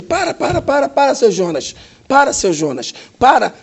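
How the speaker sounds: rising, urgent pleas to stop. Retold or imitated. Doctor urging the patient to stop moving, excited. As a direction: hold him back, urgently.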